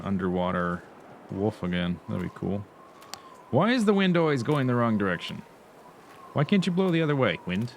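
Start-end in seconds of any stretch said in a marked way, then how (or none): 3.5-7.7 louder, higher mock complaint; exasperated. Real, not acted. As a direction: scold the wind in exasperation. Complaining to the wind.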